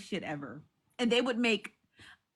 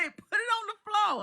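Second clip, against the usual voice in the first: imitating [name]; 10 semitones higher than usual. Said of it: "when [name] say".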